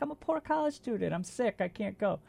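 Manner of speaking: high, pleading whine; whiny high plea; pleading student. Retold or imitated. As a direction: beg them like a broke sick student. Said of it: "I'm like".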